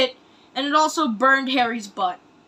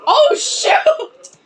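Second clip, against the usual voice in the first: surprised outburst; 13 semitones higher, 5 dB louder than usual. Real, not acted. Reacting to the dragon's fiery breath.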